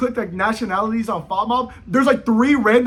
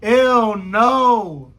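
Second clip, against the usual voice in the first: mock disgust; 2 semitones higher, 6 dB louder than usual. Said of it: "They're like".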